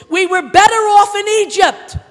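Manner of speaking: loud, high mock grumbling; shouted complaint. Israelites complaining to Moses, shouted by the preacher. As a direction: hurl the Israelites' complaint out, acted. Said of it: "They complained".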